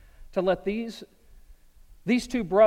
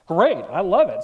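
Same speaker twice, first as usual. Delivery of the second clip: loud declaration; 11 dB louder than usual. Real, not acted. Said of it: "preacher's joy in ministry".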